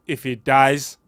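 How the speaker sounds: halting, lightly Russian villain voice; a try at a Russian accent; attempted Drago. Shown as a demonstration. Second go at Drago quote, light Russian accent. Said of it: "not mocking the Russian man".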